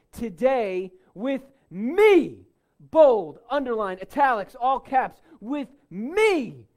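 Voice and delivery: ME punched out loud and high; great emphasis on ME. Shown as a demonstration. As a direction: preach it forcefully, booming on ME each time. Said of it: "there's great emphasis on this phrase "with me".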